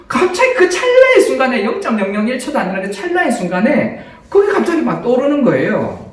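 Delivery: ordinary talk, nothing marked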